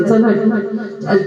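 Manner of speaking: slow, drawn-out dismissive shoo; imitating the guru shooing someone away; mock scolding shoo. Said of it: "Maharaj एखाद्याला ओरडायचे".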